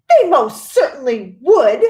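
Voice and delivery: indignant mother crying out in defence of her son. A narrator's reading. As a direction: cry it out, deeply offended. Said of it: "cried Miss Gloop indignantly".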